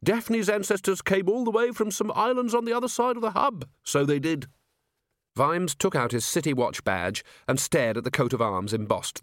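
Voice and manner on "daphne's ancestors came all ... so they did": put-on high-pitched rustic old-timer; old man keeper. Old caretaker explaining the animals to a visitor, high old-man voice.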